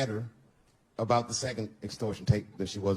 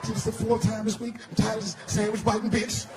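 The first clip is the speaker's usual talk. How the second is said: put-on indignant kid; 10 semitones higher, 3 dB louder than usual. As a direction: drag out the outrage like a kid. Son's high kid voice.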